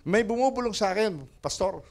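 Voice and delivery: a hint of an eager friend; friend on phone. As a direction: friend telling the pastor about the whisper. Friend voiced on phone call.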